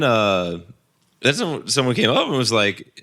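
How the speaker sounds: normal talking voice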